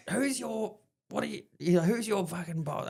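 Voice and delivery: high-pitched mock officer challenge; indignant demand. Officer challenges a scruffy man on base, indignant demand. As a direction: demand answers like an officious superior.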